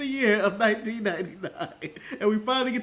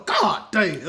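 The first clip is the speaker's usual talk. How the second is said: J R; 4 semitones lower than usual. Retold or imitated. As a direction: lower imitated commentator's exclamation. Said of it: "J R just going".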